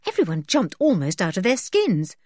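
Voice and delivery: usual speaking voice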